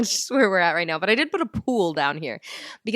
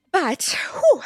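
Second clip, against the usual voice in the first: out of breath; 11 semitones higher, 3 dB louder than usual. Real, not acted. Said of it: "I gotta catch my breath".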